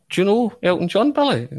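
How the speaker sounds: mock astonishment, voice jumping way up; amazed question. John Clark voiced, amazed.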